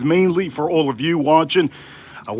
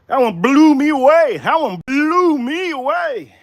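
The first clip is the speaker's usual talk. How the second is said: blown away; 8 semitones higher than usual. Real, not acted. Streamer raving to viewers about a taste, blown away. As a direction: savour it with big, high, stretched emphasis.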